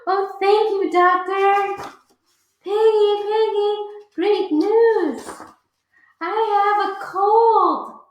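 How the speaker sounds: high, loud gleeful voice; overjoyed. Acted. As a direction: sing out the happy news. Joyful elephant.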